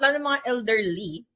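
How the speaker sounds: ordinary delivery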